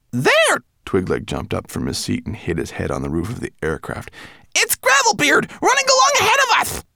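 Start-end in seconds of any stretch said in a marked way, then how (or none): 0.1-0.6 high, sudden There; excited shout. A narrator's reading. Twigleg spots the dwarf and shouts to Lola, high. 4.5-6.8 high, fast, urgent cry; excited shout. A narrator's reading. Twigleg shouts to Lola that Gravelbeard runs ahead, high and fast.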